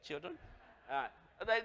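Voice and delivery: usual speaking voice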